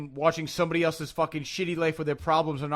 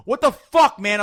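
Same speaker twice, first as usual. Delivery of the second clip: high, loud indignation; reenacted road rage yell; retold outraged shout; 5 semitones higher, 8 dB louder, slower than usual. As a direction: explode at the other driver. Retold argument with a driver.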